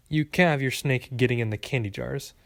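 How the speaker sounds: ordinary delivery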